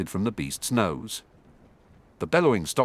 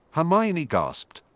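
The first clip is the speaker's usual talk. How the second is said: ordinary delivery, nothing marked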